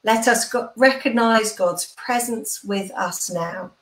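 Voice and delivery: ordinary talk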